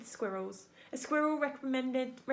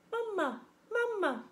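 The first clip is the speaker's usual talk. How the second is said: put-on high little baby-bird call; peeping gosling; 10 semitones higher than usual. A narrator's reading. Gosling calls the robot its mother, peeping high. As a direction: chirp it up high, falling away. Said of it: "peeped the gosling".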